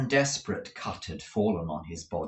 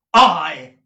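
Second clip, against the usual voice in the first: bold reply; 6 semitones higher, 14 dB louder than usual. Acted. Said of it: "said Nicholas".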